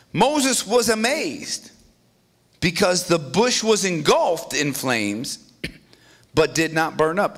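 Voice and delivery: normal talking voice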